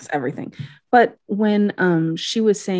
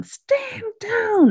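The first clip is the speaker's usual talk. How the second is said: mock scream. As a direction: imitate a pained scream at reduced volume. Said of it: "Billy is screaming for his kin".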